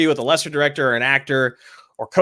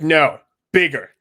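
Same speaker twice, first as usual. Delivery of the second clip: director's note; slower than usual. Retold or imitated. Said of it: "the director was like".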